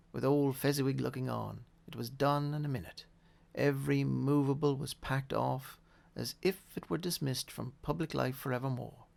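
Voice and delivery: plain reading voice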